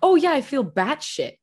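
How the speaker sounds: ordinary talk, nothing marked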